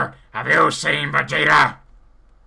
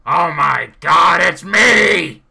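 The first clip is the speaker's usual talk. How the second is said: surprised shout; 4 semitones higher, 6 dB louder than usual. Acted. Sees another Nappa.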